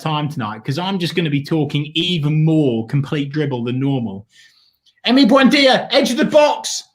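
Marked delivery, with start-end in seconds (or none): 5.1-6.8 higher and louder, rising to the chance; real excitement as the ball reaches the box; excited commentary. Real, not acted. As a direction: call the attack as it happens, thrilled. Live goal chance on screen.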